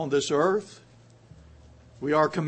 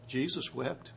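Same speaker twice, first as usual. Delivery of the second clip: hushed; 3 semitones lower, 13 dB quieter than usual. Real, not acted. Quiet punchline after rhetorical question.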